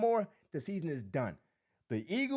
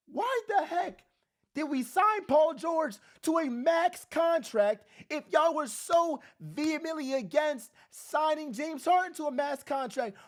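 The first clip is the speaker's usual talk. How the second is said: exasperated outburst; 7 semitones higher than usual. Retold or imitated. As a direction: throw the question at the front office. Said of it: "I'm like".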